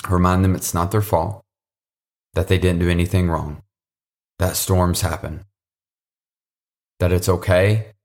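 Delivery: closing prayer. Acted. Closing prayer over the listeners.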